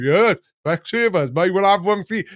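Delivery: a hint of a cheeky grandpa answering the comedian; imitating Grandpa.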